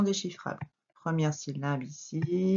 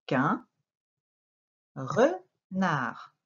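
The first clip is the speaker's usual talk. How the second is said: very slow "Cette petite corneille", each word on its own; word-by-word reading; much slower than usual. Shown as a demonstration. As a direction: cut the sentence into words and syllables with long pauses, "re-nard". Teacher reads sentence word by word.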